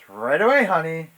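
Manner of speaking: loud, high reply from across the shop; calling back cheerily. Acted. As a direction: sing out an obliging answer. Jeffrey calls back to Martha.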